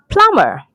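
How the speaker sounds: "plumber" as PLUM-er, no b; silent B. Shown as a demonstration. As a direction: rhyme "plumber" with drummer. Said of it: "The B is silent".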